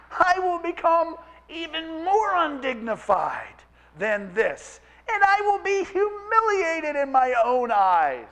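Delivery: high, slow declaration ringing out; proud declaration. Acted. David vowing to be undignified before God, ringing out. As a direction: proclaim it with joyful defiance.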